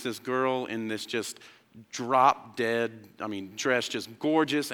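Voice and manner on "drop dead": ordinary talk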